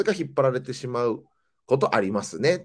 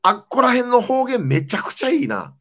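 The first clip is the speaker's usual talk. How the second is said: high, loud enthusiasm; excited praise; 12 semitones higher, 5 dB louder than usual. Real, not acted. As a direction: gush with real delight. Enthusing about dialects heard on tour.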